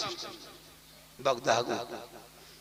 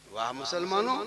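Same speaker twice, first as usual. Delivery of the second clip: normal talking voice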